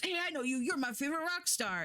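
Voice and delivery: high, excited fan imitation; starstruck. Lobo recognizing his favorite rock star, starstruck. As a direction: play an eager fan, voice lifted well up.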